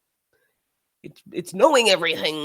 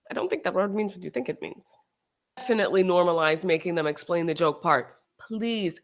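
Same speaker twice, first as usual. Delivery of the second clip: ordinary talk, nothing marked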